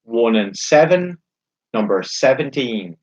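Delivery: slow, drawn-out bingo calling; bingo caller. Acted. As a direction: call each number slowly, then repeat it.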